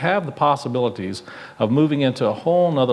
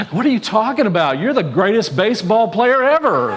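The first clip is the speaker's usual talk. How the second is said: high, louder excited puppy voice; excited little dog; 8 semitones higher, 5 dB louder than usual. Retold or imitated. Little dog cheering up the dejected boy, high and excited. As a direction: bounce in like an eager little dog. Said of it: "his little dog is bouncing around going".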